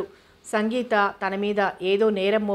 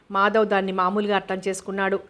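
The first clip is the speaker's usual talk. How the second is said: usual speaking voice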